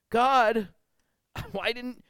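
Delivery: high, aggrieved question with a laugh; puzzled complaint. Retold or imitated. Drowned man asking God why, hurt and puzzled. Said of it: "he says".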